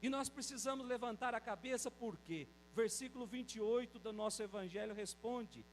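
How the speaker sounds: usual speaking voice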